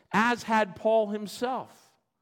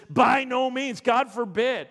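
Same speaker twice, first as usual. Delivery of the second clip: louder and higher, By no means, God forbid; forceful denial; 5 semitones higher, 5 dB louder than usual. Acted. Preacher voicing Paul's indignant denial to the church, loud and high.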